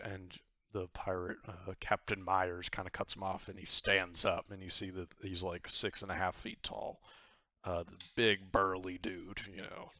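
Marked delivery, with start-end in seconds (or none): none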